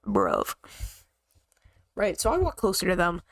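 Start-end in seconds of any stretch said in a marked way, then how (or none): 0.0-0.5 unimpressed. Retold or imitated. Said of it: "And I'm like".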